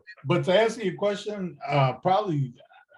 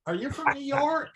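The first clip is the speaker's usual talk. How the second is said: higher puzzled question, softer; confused Floridian; 10 semitones higher, 4 dB quieter, slower than usual. Retold or imitated. Florida locals quizzing the Brooklyn guy, puzzled. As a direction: mimic the locals hearing his accent. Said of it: "They're like".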